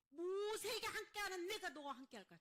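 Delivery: loud, high proclaimed promise; forceful reassurance. Acted. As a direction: raise the voice to a preacher's cry of assurance. Same promise repeated, now proclaimed. (크고 높게 선포하듯 안심시키는 말)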